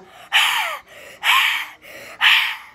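repeated heaving mock sobs; loud fake sobbing. Shown as a demonstration. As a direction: heave out loud airy pretend sobs. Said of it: "you can hear the".

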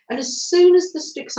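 usual speaking voice

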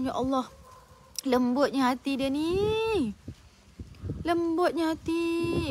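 slow, drawn-out marvelling; retold amazement. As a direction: stretch the words in mild wonder. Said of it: "saya terkejut".

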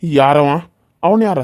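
loud suspicious challenge; angry demand. Acted. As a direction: bark the question. Villain jealous at the suitor. (ಕೋಪದ ಪ್ರಶ್ನೆ)

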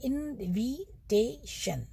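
इनविटेशन very slowly, each syllable drawn out and separate; slow demonstration of इनविटेशन in four beats; sharply articulated syllables. Shown as a demonstration. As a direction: articulate every part of इनविटेशन. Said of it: "बिल्कुल sharpen से होते हैं".

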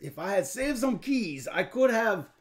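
put-on French accent, lively and lilting; theatrical fake French accent; weird French voice. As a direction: explain it as a mock Frenchman. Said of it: "you get to talk in a weird voice".

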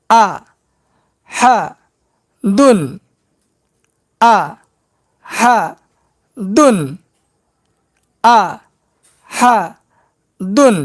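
আ হা দুন said as separate beats; syllable drill; broken syllables. Shown as a demonstration. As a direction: split আহাদুন into three spaced syllables. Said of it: "এবার ভাঙা ভাঙা উচ্চারণ করব".

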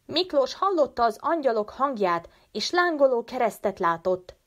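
a child defends Miklós, mildly voiced excited cry. A narrator's reading. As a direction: protest eagerly like a believing child. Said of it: "kiáltotta egy másik gyerek".

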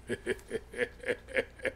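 soft chuckle. Real, not acted. Preacher after saying "rectitudes", soft chuckle. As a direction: chuckle quietly to yourself. Chuckles at own word choice.

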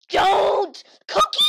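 loud shriek rising to a very high scream; panicked yell. Real, not acted. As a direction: scream to stop her. Player opens forbidden file.

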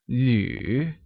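旅 falling low, then rising; third tone. Shown as a demonstration. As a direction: dip deep on 旅 and swing back up. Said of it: "pronounced using the third tone".

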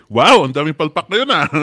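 loud, high laugh through the words; laughing exasperation at the mistakes. Real, not acted. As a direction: laugh at all the blunders. Laughing at many blunders.

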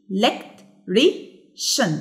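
electrician in four slow, separate beats; syllable by syllable. Shown as a demonstration. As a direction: pause after every syllable of electrician. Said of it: "يبقى four syllables". (مقطّعة ببطء إلى مقاطع)

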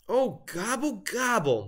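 high-pitched dismayed gobble, falling away; moaning turkey. A narrator's reading. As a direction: moan it as a let-down turkey. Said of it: "moaned Turkey".

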